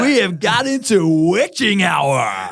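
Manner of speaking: imitating the host calling witching hour; mock radio host. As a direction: ham up the DJ catchphrase. Imitating the host's catchphrase.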